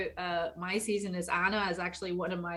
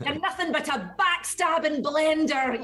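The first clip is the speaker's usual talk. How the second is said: high, loud hammed-up insult; Horizon; 8 semitones higher, 7 dB louder than usual. Actor showing her character's different side, mock-angry. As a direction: hurl it as a mock-outraged game line. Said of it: "it was very much like".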